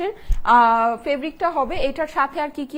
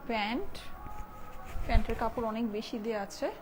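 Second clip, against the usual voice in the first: hushed, softer voice during the call to prayer; speaking softly; 10 dB quieter than usual. Real, not acted. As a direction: keep your voice down out of respect. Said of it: "আমি একটু আস্তে কথা বলি". (আজানের সময় নিচু গলায় আস্তে কথা বলা)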